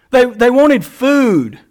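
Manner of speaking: slow, drawn-out relish with a wink; tongue in cheek. Real, not acted. Preacher joking about the crowd's appetite, tongue in cheek. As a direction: stretch out each word, playfully teasing. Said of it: "I say that tongue in cheek".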